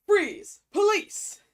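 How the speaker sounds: high, loud shouted order; loud cop shout. Acted. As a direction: bark it like a cop on a raid. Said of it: "Nope, wrong voice".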